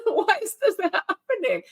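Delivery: high, laughing bafflement; laughing disbelief. Retold or imitated. Reading a troll scolding trolls online, laughing baffled question. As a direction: ask it while laughing at the absurdity. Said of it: "And I said".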